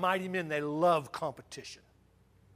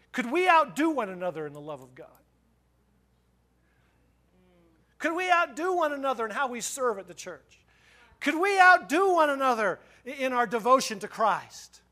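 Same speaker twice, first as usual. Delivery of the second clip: higher-pitched, building to a loud appeal; rousing preaching challenge; 6 semitones higher, 7 dB louder than usual. Real, not acted. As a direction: spur the congregation on, each question stronger.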